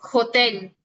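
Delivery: hotel said with no H sound, as otel; teacher shows students hotel with a silent H. Shown as a demonstration. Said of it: "nunca van a escuchar un sonido".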